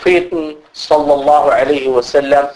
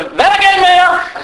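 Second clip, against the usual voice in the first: shouting in prayer; 13 semitones higher than usual. Retold or imitated. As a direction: yell at them what's wrong. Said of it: "Råber og skrig i salat".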